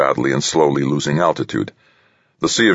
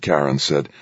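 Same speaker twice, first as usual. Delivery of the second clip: ordinary delivery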